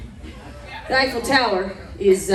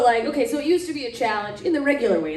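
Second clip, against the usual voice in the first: usual speaking voice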